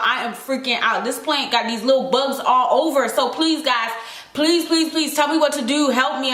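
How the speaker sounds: ordinary talk, nothing marked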